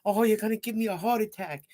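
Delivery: high, loud imitation of a scolding teacher; hammed-up exasperated scolding, pitched well up; mock yelling teacher. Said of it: "she starts yelling at me".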